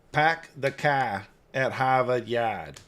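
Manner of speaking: slow, R-dropping New England accent; deliberate mock New England accent. Shown as a demonstration. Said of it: "how can I do a Maine accent?".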